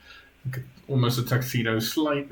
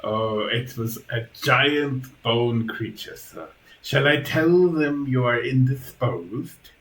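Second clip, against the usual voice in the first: slow creepy butler, rising question; Igor, deferential servant; 3 semitones higher, 4 dB louder, slower than usual. Acted. Igor reports a visitor to his master, deferential. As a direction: draw it out like a dutiful servant.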